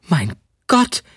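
high outcry on a jump in pitch; startled exclamation. A narrator's reading. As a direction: cry out in dismay. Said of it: "rief sie aus".

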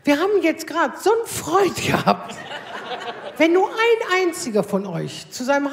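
husband protesting with a laugh. Retold or imitated.

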